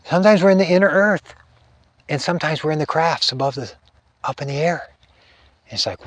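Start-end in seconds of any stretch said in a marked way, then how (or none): 0.1-4.9 high, sing-song imitation of her; imitating the wife's high channelled voice; her high lilting voice. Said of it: "she talked like this".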